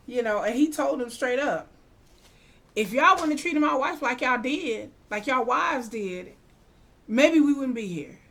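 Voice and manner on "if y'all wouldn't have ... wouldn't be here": higher, louder acted telling-off; stern rebuke. Russell telling the aldermen off, acted stern rebuke. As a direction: imitate laying it down to beggars.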